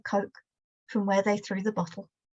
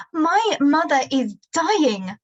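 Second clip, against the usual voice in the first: slow, loud, much higher anguished cry; mother screaming; 5 semitones higher, 4 dB louder, slower than usual. Acted. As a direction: scream it at him in grief. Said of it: "Mum screams".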